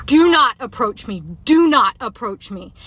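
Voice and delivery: slow, loud repeated command; retold repeated yell. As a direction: firmly warn him off, each word weighty. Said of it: "I just kept saying it over and over".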